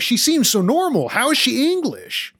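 high, slow, baffled; playful high-pitched incredulity; mock disbelief. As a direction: act stunned that she's English. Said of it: "I was like".

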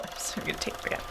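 unvoiced whisper. Real, not acted. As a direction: whisper the aside. Whispered aside after flub.

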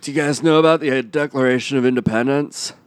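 halting movie-star impression; Nick Cage. Greeting the panel, in a halting Nick Cage impression. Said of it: "I'm Nick Cage".

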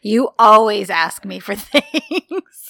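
exasperated comeback cracking into laughter; slow, loud mock complaint through a laugh; laughing retort. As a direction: act out a complaint, laughing on the last word. Acted exasperated retort, breaking into laughter.

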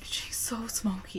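slow, hushed whisper. Real, not acted. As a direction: whisper it slowly, very softly. Whispered aside while tasting the beer.

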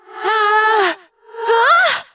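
high-pitched scream, rising on the second; falling scream. Acted. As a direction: scream as you leap off a height. Rats leap from the table edge.

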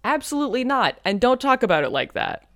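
high, indignant refusal; mock indignant refusal. Jonah to Sherlock, a high mock-indignant refusal. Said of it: "Jonah's like".

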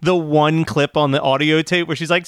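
normal talking voice

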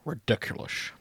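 very soft, breath-only grumble after a sigh; sighing, whispered complaint; muttered. Acted. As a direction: mutter it under your breath. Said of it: "Scotsman muttered".

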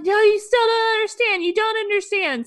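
high-pitched imitation of a rant; mock outburst. Imitating Tamara going off on Gina, high mock outburst. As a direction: wail it as a hammed-up tantrum. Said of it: "goes off on her again".

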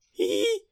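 high-pitched squealed "hee"; a tiny high giggly squeak, mimicking him; imitated giggle. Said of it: "you just hear Howie go".

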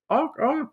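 high-pitched mock bird honks; joking stork honk. [name] jokes that she is a stork, high honking. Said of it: "flapped her elbows, making a joke of it".

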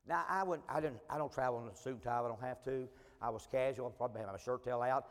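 ordinary talk, nothing marked

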